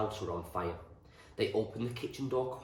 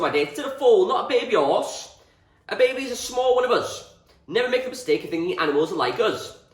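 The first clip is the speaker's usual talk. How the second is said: loud, higher booming farmer's voice; roaring; 10 semitones higher, 12 dB louder than usual. A narrator's reading. Farmer telling Anne and boys animals aren't people, roaring. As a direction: holler the lesson with hearty gusto. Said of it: "Mister Reynolds roared with laughter".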